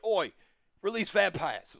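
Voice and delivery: lightly hammed-up British accent; playful fake British order. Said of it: "fake British accent".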